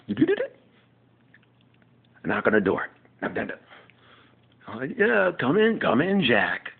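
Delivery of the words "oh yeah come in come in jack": higher, louder campy sitcom voice; Will Truman impression. Will welcoming Jack at the door in a high campy voice. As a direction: play Will greeting his friend. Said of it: "my impersonation of Will".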